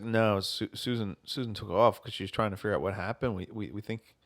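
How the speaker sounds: quiet, low, slowed solemn fake; hushed grave tone. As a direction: murmur it like bad news. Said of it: "And I was like".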